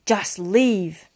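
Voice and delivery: loud, heavy command; angry dismissal. A narrator's reading. Father orders tearful Mariana away, angry. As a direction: send her away with finality.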